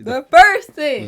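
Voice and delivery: loud, slow excited cry. Real, not acted. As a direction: shout it out with glee. Excited interruption.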